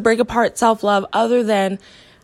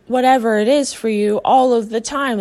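normal talking voice

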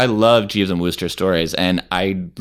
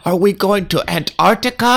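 high, thrilled question; excited wife; 12 semitones higher, 5 dB louder than usual. Retold or imitated. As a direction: squeal it like a delighted wife. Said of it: "she'll be like".

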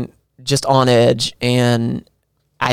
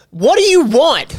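much higher, louder, slow mock yell of what do you want; hammed-up angry snap; mock angry shout; 13 semitones higher, 4 dB louder than usual. Husband mimics the fight in the casino, mock angry shout. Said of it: "You were like".

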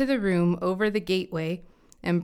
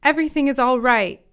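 higher, louder, quick call; crying out; 5 semitones higher, 5 dB louder, faster than usual. A narrator's reading. Messenger arriving before the king, crying out. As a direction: call out the news briskly. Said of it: "cried out to the king".

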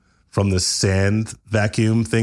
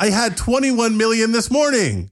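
high, panicked voice; panicking over losses; high distress; 13 semitones higher than usual. Acted. Shocked Sam.

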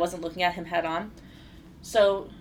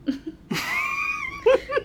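shrieking laugh. Real, not acted. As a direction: burst into a shrieking cackle. Laughs recalling her reaction.

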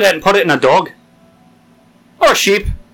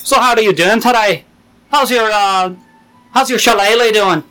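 hammed-up Irish brogue, a touch higher; mock Irish accent; 5 semitones higher than usual. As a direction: greet a customer like a stage Irishman. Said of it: "accent day".

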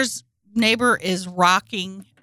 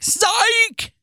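mock gotcha shout; 12 semitones higher than usual. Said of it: "One day she's gonna be like".

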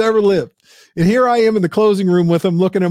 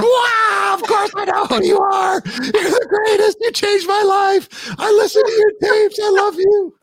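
much higher, squealing fangirl gush; gushing superfan. Retold or imitated. Salesman fawning over [name] like a screaming teen fan. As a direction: scream adoration like a teen at a concert. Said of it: "I turned into a [name] fan".

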